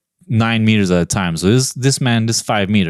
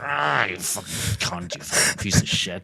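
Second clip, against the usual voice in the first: hateful snarl; 3 semitones lower, 6 dB quieter than usual. Acted. Strangling the kangaroo, hateful.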